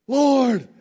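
high, loud cry of recognition; overjoyed recognition. Retold or imitated. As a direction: act out Mary crying out to Jesus. Mary recognizes Jesus.

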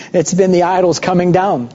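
ordinary talk, nothing marked

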